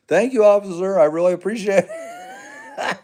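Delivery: high, loud, over-polite gratitude; mock clueless thanks. Lost visitor thanking an officer they didn't understand, mock over-grateful.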